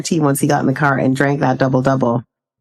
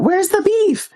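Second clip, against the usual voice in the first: slow, much higher "where's the beef" delivered as a punchline; playful punchline with a raised voice; catchphrase quote; slower than usual. Retold or imitated. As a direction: hit the old ad line slowly, pitched way up. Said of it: "I don't know if you're gonna get this reference".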